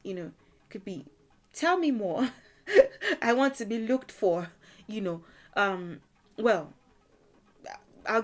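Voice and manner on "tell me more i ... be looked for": playful, laughing through the words; teasing laughter. Acted. Answering someone who says they missed you, laughing. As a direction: laugh and fish for more.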